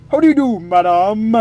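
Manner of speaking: lightly voiced posh gentleman greeting; a hint of a bowing aristocrat; fancy gentleman.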